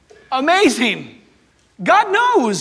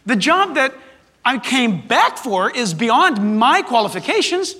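ordinary talk

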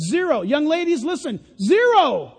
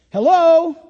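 much louder "Hello?" to wake them; loud call-out; 4 semitones higher, 7 dB louder than usual. Real, not acted. Preacher rousing the congregation, loud call.

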